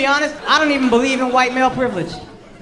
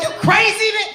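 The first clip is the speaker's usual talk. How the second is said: mock confrontation; 11 semitones higher than usual. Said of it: "and be like".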